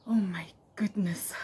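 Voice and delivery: breathy whispered gasp. Real, not acted. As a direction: hush it like a shocked aside. Reacting to reading old diary.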